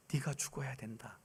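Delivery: hushed solemn pronouncement; grave hushed voice. Retold or imitated. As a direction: speak God's verdict quietly. God's voice retold in testimony.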